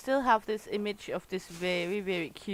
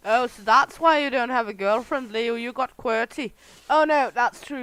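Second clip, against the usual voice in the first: teasing jab; 3 semitones higher, 8 dB louder than usual. Real, not acted. Teasing Leo about girlfriend.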